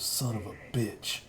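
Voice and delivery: low, quiet aside to the camera; muttered movie cop line. Retold or imitated. Host imitates Michael Biehn muttering to the camera. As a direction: mutter it like a hero looking into the lens. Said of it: "looks away to the camera and goes".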